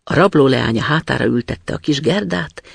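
usual speaking voice

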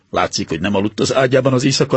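plain reading voice

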